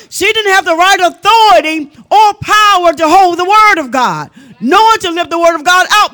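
forceful, driving sermon delivery; preaching. Real, not acted. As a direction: preach it hard, leaning on key words.

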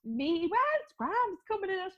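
high-pitched, giggly mock exchange; laughing re-enactment. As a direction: act out the money chat through laughter.

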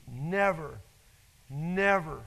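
never, never, each slow and heavy; stress on never. Acted. Preacher hammering never to the church. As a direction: lean hard on never.

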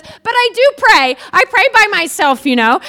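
high, loud, slowed call over the shoulder; shouting back while running down the hall; woman calling back as she flees down the hall, high and loud; running-away protest. Retold or imitated. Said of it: "saying all this stuff as she's running down the hall".

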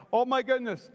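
ordinary talk, nothing marked

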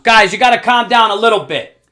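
high, loud parental shout; real yell. Real, not acted. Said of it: "Now I just yelled at my daughter".

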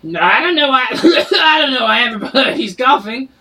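high, loud barkeep breaking into a laugh. Acted. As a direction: stay in the barkeep voice while laughing. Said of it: "His voice does not match his description".

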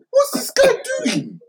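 high, incredulous cry of disbelief. Real, not acted. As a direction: say it like you can't believe your eyes. Outraged fan describing the punch.

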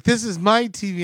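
a hint of an insistent old lady; lightly voiced old lady. Old lady claiming the TV, lightly imitated. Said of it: "This old lady comes up and she's like".

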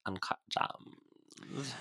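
slow breathy whisper; whispered vocal fry. Retold or imitated. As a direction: breathe it out slowly. Repeating catchphrase whispered.

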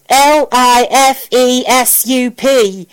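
slow, loud letter-by-letter spelling; spelled out. A narrator's reading. Al spells out the reading for Sam, letter by letter. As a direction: spell each letter out high and firmly. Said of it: "he spelled out".

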